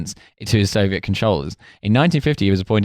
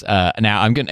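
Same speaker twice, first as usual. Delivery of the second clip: usual speaking voice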